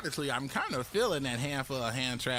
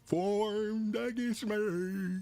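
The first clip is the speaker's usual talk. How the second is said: preaching to church; 9 semitones higher, much slower than usual. Acted. Streamer leading chat as church, slow high sermon. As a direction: preach it slowly to the congregation. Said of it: "Say it with me, church".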